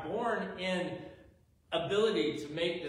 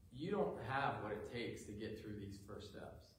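soft, low discouraging voice; unintimidating Satan; 3 semitones lower, 6 dB quieter than usual. Acted. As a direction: quietly talk the listener out of trying. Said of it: "isn't even an intimidating one".

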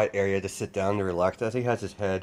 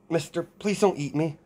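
put-on higher-pitched, mildly frightened child's plea; cowering little girl; 8 semitones higher than usual. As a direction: plead lightly like a scared little girl. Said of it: "she looks up and cowers".

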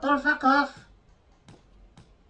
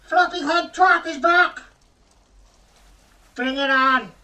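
loud taunting; 3 semitones higher, 8 dB louder than usual. Real, not acted. Enemy returns.

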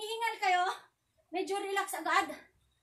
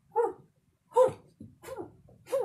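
short exhaled hu on each strike; kiai grunts; 4 semitones higher, 6 dB louder than usual. Shown as a demonstration. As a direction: snap out a hu per punch. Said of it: "number one, ulit". (matinding hu sa bawat suntok)

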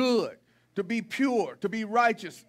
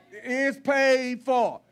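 slow, weighty "paid for"; slow emphasis; much slower than usual. Shown as a demonstration. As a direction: lean on every word, slowly. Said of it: "but it's paid for".

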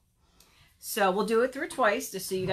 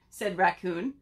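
ordinary delivery, nothing marked

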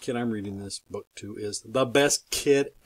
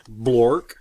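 silly loud nonsense word; 9 dB louder than usual. Acted. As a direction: blurt it out loud. Reading silly words aloud.

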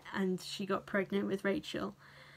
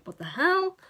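mock outrage; 8 semitones higher, 4 dB louder than usual.

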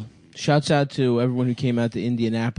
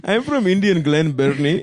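put-on Indian accent; 3 semitones higher, 3 dB louder than usual. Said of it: "in an Indian voice".

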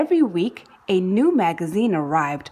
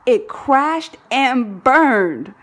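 slow dramatic telling; 3 semitones higher, 4 dB louder, much slower than usual. A narrator's reading. Third rocket fails.

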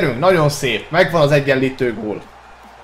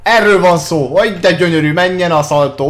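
high, loud joyful shouting; excited goal cheer; 5 semitones higher, 4 dB louder than usual. Real, not acted. Goal celebration while playing.

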